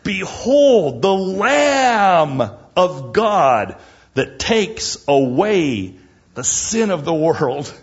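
high, loud, slow proclamation; Baptist crying out. Retold or imitated. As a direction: cry it out like a prophet. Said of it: "the Baptist cries out".

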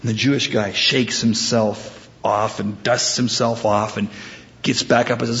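usual speaking voice

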